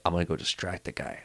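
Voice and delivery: very soft, secretive voice; hushed plotting. Retold or imitated. Friend planning to distract the vendor, hushed plotting. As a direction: keep it down like an accomplice.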